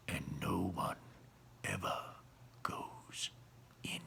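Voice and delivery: soft, breathless hush; soft whisper. A narrator's reading. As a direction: let the secret trail off. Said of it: "lowered his voice to a soft whisper".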